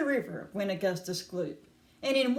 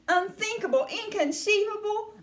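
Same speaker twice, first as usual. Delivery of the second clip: indignant Wonka; 10 semitones higher, 3 dB louder than usual. A narrator's reading. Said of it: "said Mister Wonka".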